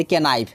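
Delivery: "केनाइफ" with the silent K sounded; K pronounced. Retold or imitated. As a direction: say "केनाइफ" as learners misread it, starting "ke-". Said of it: "पढ़ते थे केनाइफ". ("केनाइफ" में के का गलत उच्चारण)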